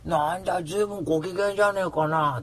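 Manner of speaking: the impression that gets a laugh from the girl; うーちゃん impression. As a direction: rib him like his buddy would. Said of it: "うーちゃんの真似するとやっぱりウケますね".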